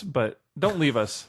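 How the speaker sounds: ordinary talk, nothing marked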